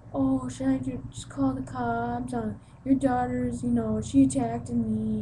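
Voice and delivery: mock fake phone call. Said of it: "she pretends to talk".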